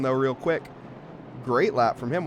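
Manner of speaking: ordinary delivery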